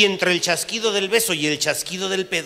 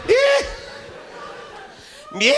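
a much higher, louder panicked yelp; nervous squeak; 5 dB louder than usual. Retold or imitated. Answering his general in panic, a high squeak.

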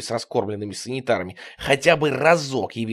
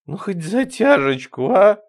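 slow, raised, wheedling plea; plaintive begging; 7 semitones higher, 6 dB louder, slower than usual. A narrator's reading. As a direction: beg for just a little, dragging it out. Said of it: "жалобно протянула она". (жалобная протяжная просьба)

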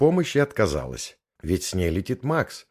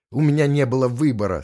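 higher and louder, desperate; despairing; 6 semitones higher, 6 dB louder, faster than usual. Acted. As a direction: plead with no way out. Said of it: "с отчаянием произнесла Макс".

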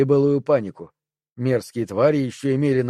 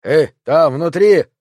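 loud call from behind a door; calling through door; 3 semitones higher, 7 dB louder, slower than usual. Acted. Annoyed neighbour calls loudly through the door. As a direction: call out to whoever's inside. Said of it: "позвал голос из-за двери".

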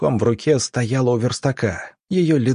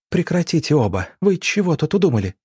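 higher, fast alarmed shout; 6 semitones higher than usual. Acted. Yevgenia shouts at the two fighting men, alarmed shout. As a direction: shout to break them apart. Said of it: "Евгения крикнула".

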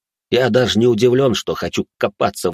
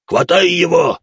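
loud, higher demon bark; demonic bark; 9 semitones higher, 5 dB louder, slower than usual. Acted. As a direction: bark the order like a brute. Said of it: "гаркнул пузатый".

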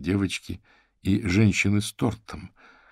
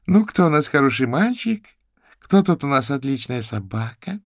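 put-on doggy baby talk; 8 semitones higher, 5 dB louder than usual. A narrator's reading. Baby-talking the dog.

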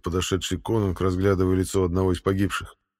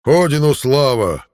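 battle cry; 6 semitones higher, 8 dB louder, slower than usual. Acted. Viking battle cry in fight.

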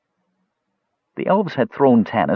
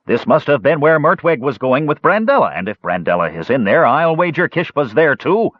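higher, heated accusation to a crowd; mild shout; 7 semitones higher, 3 dB louder than usual. A narrator's reading. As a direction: rouse the mob with a suspicion. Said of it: "A stocky elf ... shouted".

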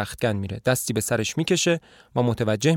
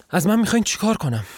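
helpless plea; 8 semitones higher, 3 dB louder than usual. A narrator's reading. Wife pleading, lost.

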